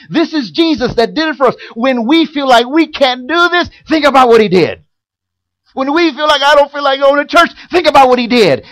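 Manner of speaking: loud, rising preacher's call to the church; full-voiced sermon climax; preaching at full force. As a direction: preach it hard and loud, driving each line home.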